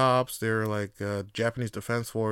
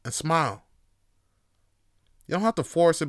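ordinary talk, nothing marked

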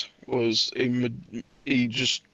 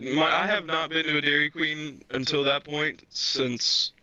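ordinary delivery

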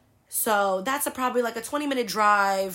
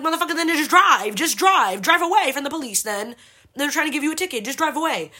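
louder, higher, fed-up shouting at dad; exasperated yell; 7 semitones higher, 6 dB louder than usual. Retold or imitated. Daughter yelling at her dad to escape the cop, exasperated. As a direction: snap at him to just drive off.

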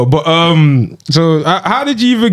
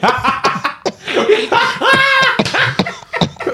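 high laugh; 3 dB quieter than usual. Real, not acted. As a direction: burst out laughing. Big laugh after intro.

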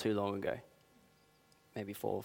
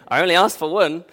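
laughing; 7 semitones higher, 11 dB louder than usual. Real, not acted. Laughing over the catch.

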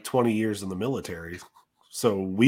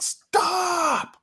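calling out. Retold or imitated. Yelling at the dog.

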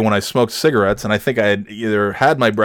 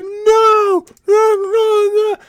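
high, frantic cries of no; frantic stranger; much slower than usual. Retold or imitated. Stranger waves at the broken-down car, high frantic no. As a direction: mimic a panicking stranger. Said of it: "He's screaming at me".

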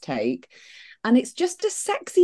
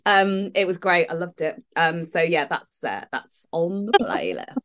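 ordinary talk, nothing marked

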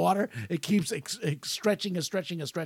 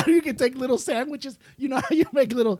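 laughing; 7 semitones higher, 4 dB louder than usual. Real, not acted. Still laughing, talking fast.

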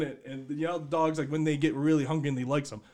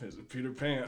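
usual speaking voice

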